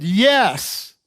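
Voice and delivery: loud, forceful acted answer; emphatic mad yes. Kid answering dad's offer to change, emphatic. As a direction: burst out with a loud, angry yes. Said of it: "I was mad".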